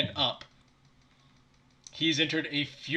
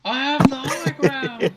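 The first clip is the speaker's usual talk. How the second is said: dramatic movie quote; 8 semitones higher, 5 dB louder, slower than usual. Acted.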